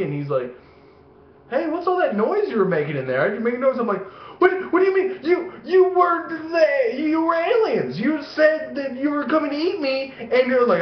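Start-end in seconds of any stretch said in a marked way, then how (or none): 1.5-3.8 higher-pitched casual questioning; dad feigning innocence. Retold or imitated. Dad watching TV questions his kid, feigned innocence. 4.4-10.1 mock child distress. Kid confronting dad about the aliens, mock tearful disbelief. Child self protesting, upset.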